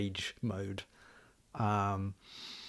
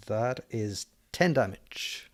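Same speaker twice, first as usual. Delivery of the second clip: usual speaking voice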